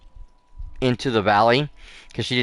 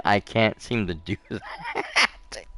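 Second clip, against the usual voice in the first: normal talking voice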